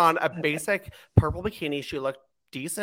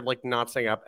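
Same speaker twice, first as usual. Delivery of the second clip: normal talking voice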